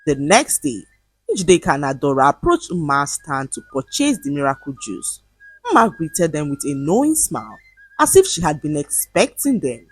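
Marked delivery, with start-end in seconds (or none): none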